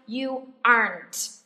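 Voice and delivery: slow model "aren't", one long syllable "ahnt"; "aren't" slowly modelled without a second vowel; correct aren't. Shown as a demonstration. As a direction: say "aren't" as a single drawn-out "ahnt" with a final t. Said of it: "đọc là aren't là sai".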